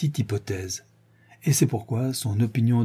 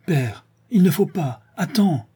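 high-pitched urgent plea; crying out; 7 semitones higher than usual. A narrator's reading. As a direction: cry out to stop him, pitch jumping up. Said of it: "s'écria Suzanne".

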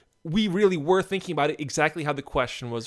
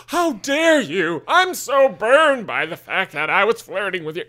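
hammy offended gasp voice; overacted indignant huff; mock outrage; 3 semitones higher, 5 dB louder than usual. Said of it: "You're like, gasps".

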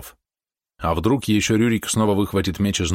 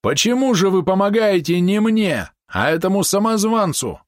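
exclaiming; 10 semitones higher than usual. A narrator's reading. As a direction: cry out to the gods. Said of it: "воскликнул Вадим".

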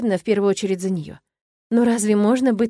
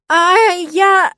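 high, loud startled shout; flustered; 9 semitones higher, 8 dB louder than usual. A narrator's reading. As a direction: sputter in shock. Victoria caught off guard.

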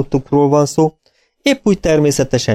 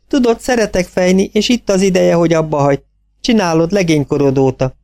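higher-voiced daughter, gently persuading; young daughter voice; 8 semitones higher than usual. A narrator's reading. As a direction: coax your father, pitched higher. Daughter Olive speaks. (magasabb hangú lány, kedvesen rábeszélő)

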